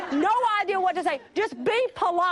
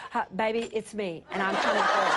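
deep husband voice; 8 semitones lower than usual. Retold or imitated. Husband calling to his frightened wife in the dark, low and tender. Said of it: "And this voice says".

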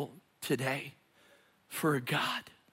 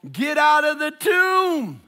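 high, loud and slow shout; 12 semitones higher, 9 dB louder, slower than usual. Real, not acted. Preacher shouting the question at the congregation. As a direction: blast the question out.

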